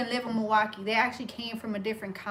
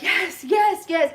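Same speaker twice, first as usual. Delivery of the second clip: higher, louder burst of joy; relieved excited yes; 6 semitones higher, 6 dB louder, slower than usual. Retold or imitated. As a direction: cheer it out as she comes back. Victim starts breathing again.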